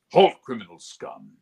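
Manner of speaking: loud, stern guard bark; imperial guard. Shown as a demonstration. Actor demoing the guard's challenge. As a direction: stop the criminal in his tracks. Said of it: "The Imperials all sounded like this".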